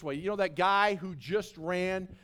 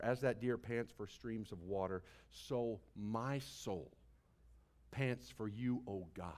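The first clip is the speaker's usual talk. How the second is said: much softer, low, intimate; soft scripture reading; 11 semitones lower, 12 dB quieter than usual. Real, not acted. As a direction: read it like a whispered prayer. Reading psalm softly.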